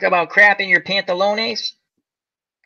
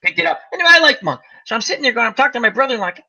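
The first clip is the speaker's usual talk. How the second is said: ordinary talk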